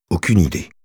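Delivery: plain reading voice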